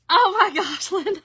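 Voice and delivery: loud, high laugh through the words. Real, not acted. Host cracking up at a viewer's comment, laughing. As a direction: burst out laughing as you speak.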